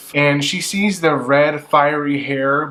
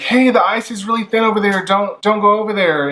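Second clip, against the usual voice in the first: calling out; 7 semitones higher, 4 dB louder than usual. Retold or imitated. People calling warning across ice.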